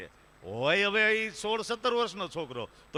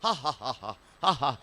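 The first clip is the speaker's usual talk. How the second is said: hollow forced "ha ha ha" laugh; mock forced laughter, done for the crowd; fake laugh; 3 semitones lower than usual. Shown as a demonstration. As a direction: show off a put-on morning laughter-club laugh. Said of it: "ઈ ખોટા હાસ્ય કહેવાય".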